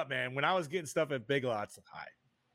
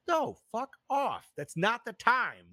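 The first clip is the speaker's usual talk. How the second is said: slow, higher, hammed-up fed-up snap; mock angry refusal; 8 semitones higher, 4 dB louder, slower than usual. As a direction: stretch it out like a fed-up clerk. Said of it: "It's like".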